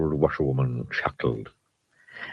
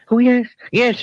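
high, louder panicked cry; cried in a panic; 6 dB louder than usual. A narrator's reading. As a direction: blurt it out in a panic. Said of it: "he cried".

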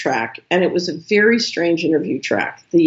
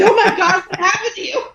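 much higher, laughing in disbelief; shocked exclamation. Retold or imitated. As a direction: gasp it out, stunned and amused. Assistant fails to recognize her.

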